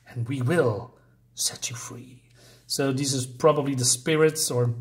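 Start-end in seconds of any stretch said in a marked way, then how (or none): 0.1-2.2 slow eerie whispered promise; ghostly whisper. Acted. Spirits whisper a slow promise of freedom to the pilgrim. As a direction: whisper the bargain slowly.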